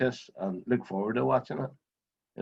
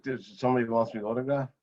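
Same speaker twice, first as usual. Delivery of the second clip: normal talking voice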